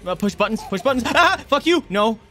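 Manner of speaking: high loud desperate yell; panicked shouting. Real, not acted. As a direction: scream at the controls. Frantic last seconds.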